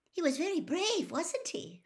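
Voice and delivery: a hint of an admiring schoolgirl; lightly voiced admiring girl. Hermione's admiring line after match.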